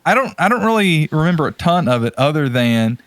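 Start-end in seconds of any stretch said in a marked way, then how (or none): none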